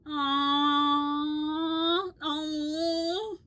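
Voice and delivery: loud, high, melting aww; touched aww. Real, not acted. Streamer seeing her mom's photo, a loud touched aww. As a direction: coo at the sweet photo.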